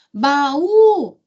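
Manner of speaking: "baú" with the Ú held high and long; "baú" stretched on its last syllable; calling the word. Shown as a demonstration. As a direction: call out "baú" to expose the stressed Ú. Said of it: "chama a palavra".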